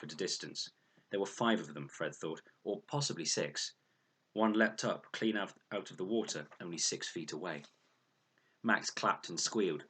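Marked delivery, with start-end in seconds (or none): none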